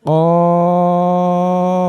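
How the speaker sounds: কফ held long, tongue root on the palate; long qaf demo. Shown as a demonstration. Teacher holds কফ for learners to copy. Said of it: "আপনি আমার সাথে সাথে বলুন".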